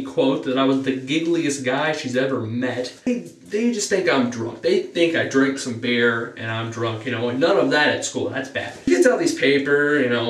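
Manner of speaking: normal talking voice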